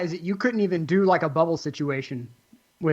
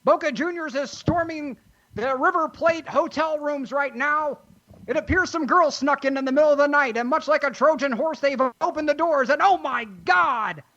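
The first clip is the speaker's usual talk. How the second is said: high, breathless forties newsreel announcer; old newsreel; 9 semitones higher than usual. Acted. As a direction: rattle it off like a 1940s newsreel. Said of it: "forties newsreel stuff".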